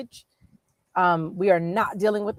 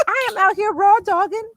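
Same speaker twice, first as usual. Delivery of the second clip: much higher, squealing with glee and laughter; gleeful squeal; 13 semitones higher, 5 dB louder than usual. Retold or imitated. Answering the Instagram post, a high laughing squeal. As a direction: shriek it out like you've found your people.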